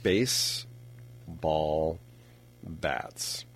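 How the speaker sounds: baseball bats stretched very slowly and softly; drawn-out, amused repeat of the answer. Real, not acted. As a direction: savour each word slowly. Host savours the Mad Libs answer.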